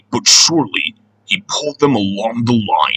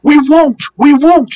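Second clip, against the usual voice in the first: very high, loud storybook squeal; shrill mock scream of protest; 5 dB louder than usual. A narrator's reading. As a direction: shriek the refusal as naughty trucks. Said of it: "screamed the trucks".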